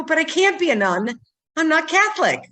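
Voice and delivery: lightly acted indignant objection; retold childlike protest.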